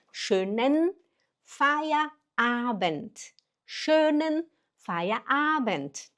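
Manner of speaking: "Schönen Feierabend" drawn out with held n; careful teaching pace on "Schönen Feierabend"; slow model. Shown as a demonstration. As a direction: stretch "Schönen Feierabend" slowly word by word. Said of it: "olha o N".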